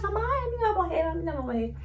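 high panicked cry, a touch of mock terror; high frantic retold panic, then fading; panicked wail. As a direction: imitate a frightened voice fearing the girl will die. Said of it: "E o Ricardo, meu Deus".

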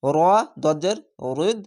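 normal talking voice

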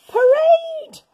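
high loud reveal; big reveal. A narrator's reading. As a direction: burst out the surprise word. Reveal of alien parade.

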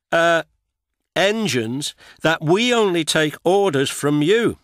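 a proud engine defending himself politely. A narrator's reading. Duck explains himself politely.

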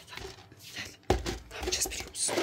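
secretive whisper. Real, not acted. Mother whispers the plan. (fısıltıyla, sır verir gibi)

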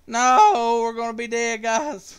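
loud, high wail of dismay; dismayed cry. Real, not acted. Streamer to viewers facing defeat, dismayed cry. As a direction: cry out as the game slips away. Opponent threatens to win the game.

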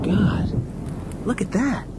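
high, hushed gasp of shock; awestruck alarm. Acted. Fergie sees the fireball, high awestruck alarm.

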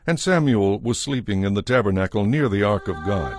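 plain reading voice

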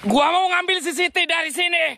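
high-pitched, sustained call. Real, not acted. Ghost hunter announcing his errand into the dark, calling high.

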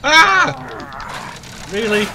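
high, loud shocked yelp; startled cry. Real, not acted. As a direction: jump at the sudden trap. Sudden trap springs in game.

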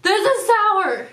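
loud, slow exaggerated cry that it is sour; mock sour outburst. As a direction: ham up a sour-faced shout. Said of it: "My face would be like".

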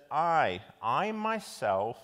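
"I" hit hard, then "I myself" drawn out slowly; emphatic I. Shown as a demonstration. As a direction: land firmly on "I", stretch "I myself". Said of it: "it really impresses upon us".

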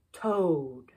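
ordinary delivery, nothing marked

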